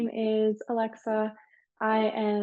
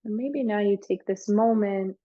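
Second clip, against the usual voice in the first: guided meditation. Real, not acted. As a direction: ease them gently through it, leaving space between phrases.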